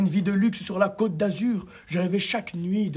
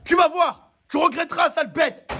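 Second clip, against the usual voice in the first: much higher furious threat; yelling threat; 9 semitones higher, 3 dB louder than usual. A narrator's reading. Bibeau yells a threat at his dog, angry. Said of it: "Bibeau lui hurla".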